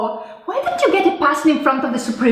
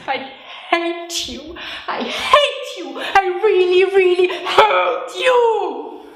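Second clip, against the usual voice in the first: slow, teasing, laughing through it; giggly slow I hate you to husband; laughing mock hate; 3 semitones higher, slower than usual. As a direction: playfully growl it out while giggling.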